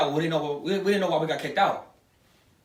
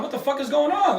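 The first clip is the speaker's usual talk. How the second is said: higher-pitched, incredulous demand; retold bewilderment; 8 semitones higher, 3 dB louder than usual. Man recounting being thrown out of the club, indignant bewilderment. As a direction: re-enact being baffled and indignant.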